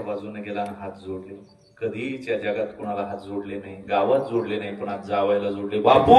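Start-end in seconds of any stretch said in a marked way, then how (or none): 5.8-6.1 loud, choked cry of "Bapu"; a father's desperate call to his son-in-law; pleading call. Retold or imitated.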